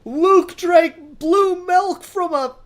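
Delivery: squeaky, slow, very high and loud mock fan voice; mock outraged fanboy. Podcaster mocking fans over Star Wars sins, hammed-up squeaky outrage. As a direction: ham up a scandalized superfan, dragging it out.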